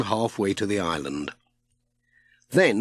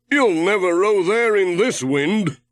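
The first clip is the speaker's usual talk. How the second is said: higher, a bit louder friendly sailor; Ted, voiced higher; 8 semitones higher, 4 dB louder than usual. A narrator's reading. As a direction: call to Pat from the boat, curious. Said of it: "said Ted".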